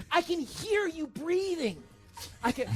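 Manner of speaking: mock creepy girlfriend. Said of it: "It's like".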